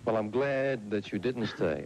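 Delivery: ordinary talk, nothing marked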